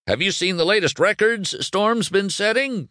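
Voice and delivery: high-pitched pushy reporter call; shouted question. Acted. Reporter questioning Lightning, shouted question. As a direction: fire the question over the crowd. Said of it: "shouted another".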